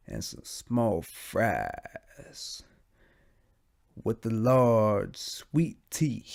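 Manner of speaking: very slow, stretched-out drowsy delivery; drawn-out silly voice. Pretend. Joking about talking like that everywhere, slow and drawn out. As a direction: drag the words, lingering on the last ones. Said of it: "What if I just talk like that".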